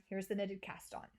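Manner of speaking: ordinary talk, nothing marked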